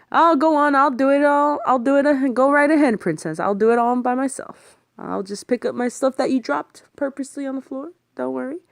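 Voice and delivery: high, mocking sarcastic grumble; mocking her for dropping his stuff; sarcastic whine. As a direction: lay the sarcasm on thick. Sarcastic complaint about dropped stuff.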